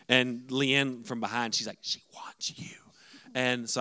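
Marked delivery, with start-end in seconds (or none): 1.8-2.7 hushed stage whisper; imitated whisper. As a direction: whisper it like a prompt from behind. Wife whispers from behind him.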